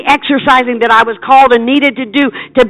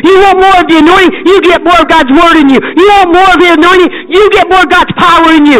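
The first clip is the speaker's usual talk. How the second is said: higher, louder, driving preacher's cadence; full-force preaching; 6 semitones higher, 5 dB louder than usual. Real, not acted. Evangelist urges the congregation toward more anointing, fervent and loud. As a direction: build the congregation up with fervor.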